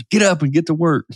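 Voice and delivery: high, barking drill sergeant yell; drill instructor voice. Retold or imitated. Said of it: "got the drill instructor voice yelling at me".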